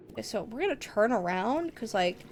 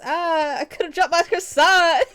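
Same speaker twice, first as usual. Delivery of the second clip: high, hammed-up meme cry; exaggerated silly outburst, pitched up; mock dismay; 7 semitones higher, 5 dB louder, slower than usual. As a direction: wail it in comic horror. Acts out a croissant meme quote.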